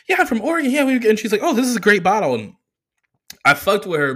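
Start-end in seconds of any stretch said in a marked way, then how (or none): 0.1-2.3 much higher, bubbly waitress voice; imitating the waitress. Comic mimicking the waitress in a high bubbly voice. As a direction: play a chirpy friendly server. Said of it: "she was like".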